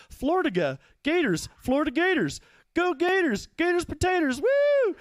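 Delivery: high whooping Southern fan chant; swampy Southern accent. Retold or imitated. Florida couple cheering the Gators, hammed-up Southern accent. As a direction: cheer in a mock Southern twang, climbing to a shrill woo. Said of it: "they had, like, a swampy southern accent".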